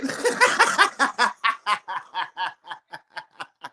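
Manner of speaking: mocking cackle; long mocking laugh. Real, not acted. Mocking fighter on camera.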